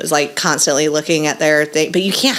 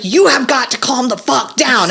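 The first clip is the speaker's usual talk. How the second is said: higher, emphatic mock scolding; exasperated mom; 6 semitones higher than usual. Mom retelling her order to a screaming child, exasperated. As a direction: lay down the law, at wit's end.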